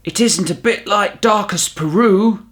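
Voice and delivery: high small bear voice, proudly announced; a polite young bear announcing to the taxi driver; Paddington announcing. Acted. Paddington tells the driver, high bear voice. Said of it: "he announced".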